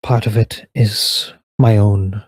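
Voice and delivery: put-on solemn elven lady speaking to an old friend; elf woman's farewell. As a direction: give it a grave, regal calm. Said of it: "she turns to him and goes".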